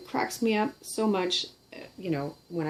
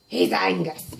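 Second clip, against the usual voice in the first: normal talking voice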